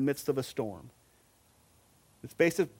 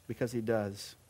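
quiet reassurance; 6 semitones lower, 7 dB quieter than usual. Real, not acted. Quiet assurance after a question.

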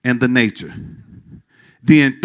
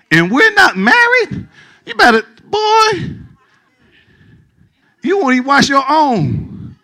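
very high, incredulous mock outrage; 10 semitones higher than usual. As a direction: squeal it in disbelief.

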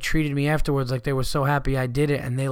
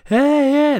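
much higher, louder mock cheer; party guests cheering; 9 dB louder than usual. Guests greeting him at his surprise party, loud cheer. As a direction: yell it like a room springing a surprise.

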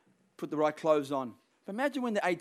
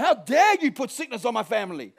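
raised, indignant mock confrontation; acted angry rebuke; 4 semitones higher, 4 dB louder than usual. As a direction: tell off sickness with righteous anger. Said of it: "You get angry".

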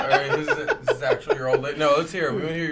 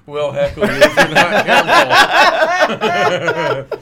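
mock scientist voice; 4 semitones higher, 6 dB louder, much slower than usual. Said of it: "scientists say".

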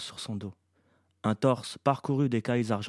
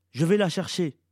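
louder, raised, determined delivery; urgent resolve; 5 semitones higher, 5 dB louder than usual. A narrator's reading. Iblis tells his mother he will follow, with urgent resolve. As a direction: announce it quickly decided and firm. Son resolves to go after her.

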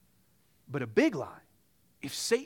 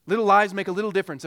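repeated much louder and quick, "lies" hit hard; louder fast repeat; 4 semitones higher, 9 dB louder, faster than usual. Shown as a demonstration. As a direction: punch it out again, louder and faster.

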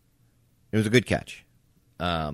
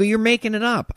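high-pitched, louder mock-indignant retort; retold protest; 5 dB louder than usual. Player retelling his quarrel with the coach, high indignant protest. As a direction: throw the accusation back, pitched high.

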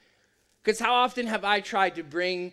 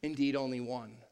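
Jesus gently rebuking; 7 semitones lower, 7 dB quieter than usual. Retold or imitated. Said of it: "Jesus responds and says".

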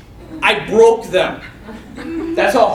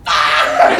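comic yell of horror; mock horrified scream; 4 semitones lower, 4 dB louder than usual. Injured man seeing his bones, hammed-up scream. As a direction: re-enact a scream at the sight.

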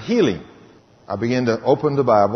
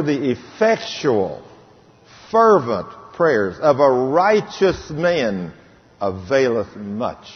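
very slow, weighty scripture; preacher slowly intoning the King James verse; much slower than usual. Acted. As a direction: stretch every word of the verse.

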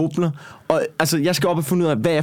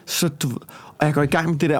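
ordinary talk, nothing marked

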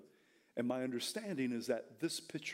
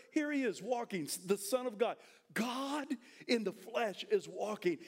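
ordinary talk, nothing marked

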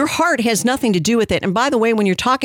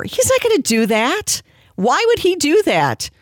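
much higher pitch, rising in disbelief; mock incredulity; 8 semitones higher than usual. Real, not acted. Host mocking the idea of a pastor crediting sermons, incredulous. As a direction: scoff at the very idea in a high voice.

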